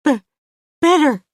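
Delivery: nervous sister stammering her answer; stuttering. Acted. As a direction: trip on the first sound, then push it out. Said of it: "She stutters".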